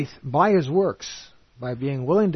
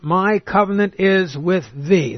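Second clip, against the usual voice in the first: slow, raised scripture reading; proclaimed scripture; 4 semitones higher, 4 dB louder, slower than usual. Acted. Preacher reading God's promise to Abram, slow and raised. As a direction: declaim it as God's own words.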